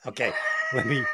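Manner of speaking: cracks up, then tries to compose himself; laughing. Real, not acted. Teased, bursts out laughing.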